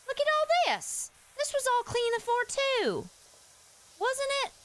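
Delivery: very high-pitched, incredulous complaint; dismayed disbelief. Real, not acted. Streamer shows viewers the undone cleaning, dismayed disbelief. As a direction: protest high in the voice, appalled.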